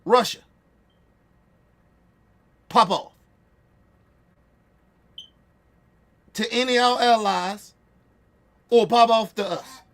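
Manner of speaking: loud, spaced-out dare to Russia; angry challenge. Real, not acted. Streamer daring Russia to pop off, loud angry challenge. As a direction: call them out, loud and defiant.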